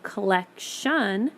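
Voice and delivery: "collection" stretched out, ending in a clear "shun"; "collection" slowly, collec-shun; broken into syllables. Shown as a demonstration. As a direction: sound out "collection", leaning on the final shun. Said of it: "you hear the sh sound now".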